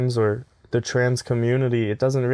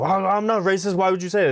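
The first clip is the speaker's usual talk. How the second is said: higher-pitched mock indignation; mock defensive protest; 8 semitones higher than usual. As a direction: act out an over-eager, defensive denial. Mimics jumping to deny racism.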